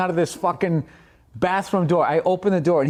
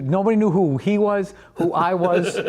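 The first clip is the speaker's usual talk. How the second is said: ordinary delivery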